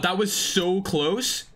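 slow, drawn-out amazed gasp at a near miss; excited disbelief. Real, not acted. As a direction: stretch it out in disbelief.

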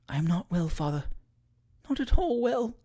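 faint, high, tearful voice; small soft voice about to cry; close to tears. A narrator's reading. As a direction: plead weakly, almost whispering. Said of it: "bursting into tears".

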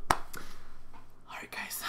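soft, voiceless whisper; whispered sign-off. Real, not acted. Vlogger signing off to viewers at night, whispering. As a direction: whisper it, fading away.

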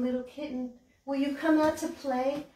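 ordinary delivery

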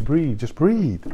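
slow, soothing coaching to breathe; calm her down, slowly. Retold or imitated. Telling an anxious lady to just breathe, slow and soothing. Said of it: "then breathe".

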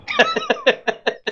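very high-pitched, tittering laugh; high laughter. Real, not acted. Player amused by the Doom bargain, laughing high. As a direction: break into a high giggle.

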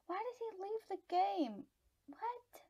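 high, gasped disbelief; real, high-voiced astonishment; incredulous dismay. Real, not acted. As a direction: protest in high-pitched bafflement. Opponent suddenly leaves the game.

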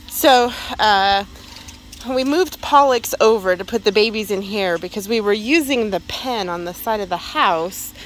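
ordinary talk

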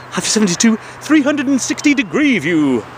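high, hyped-up show announcement; mock announcer. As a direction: announce it like a world-famous attraction. Announcing his famous view segment.

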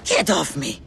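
angry. Acted. She shakes him off.

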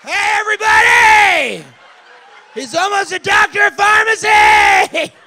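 high, loud crowd-wide holler; mock announcer shout. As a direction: call it out to the whole room.

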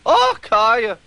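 high, loud exasperated outburst; bursting out. A narrator's reading. Twin engine losing patience with the van, loud outburst. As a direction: explode with sudden annoyance. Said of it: "Donald burst out".

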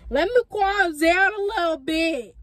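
high, loud, playful holler; hyped-up repeat. Real, not acted. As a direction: holler it, failing to calm down. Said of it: "let me bring it down".

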